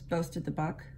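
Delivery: usual speaking voice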